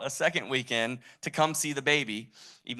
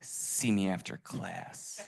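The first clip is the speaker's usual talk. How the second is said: slow, hushed, low whisper; 4 semitones lower, 6 dB quieter, much slower than usual. Retold or imitated. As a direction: imitate a secretive whisper in the ear. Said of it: "he whispers in my ear".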